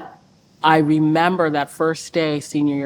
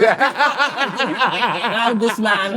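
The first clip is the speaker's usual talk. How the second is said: laughing; 6 semitones higher, much slower than usual. Retold or imitated. Mom mocking, laughing.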